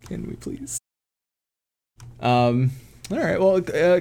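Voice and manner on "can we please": whispered plea. Real, not acted. Begging for an F-bomb.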